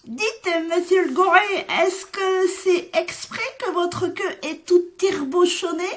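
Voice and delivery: high, bright little chick voice asking; curious chick. Acted. Chick asking the pig about his curly tail, high and innocent. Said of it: "et qui demande".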